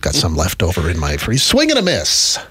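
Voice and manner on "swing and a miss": higher announcer call; baseball announcer. Pretend. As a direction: call the pitch like a broadcaster. Mock baseball play-by-play.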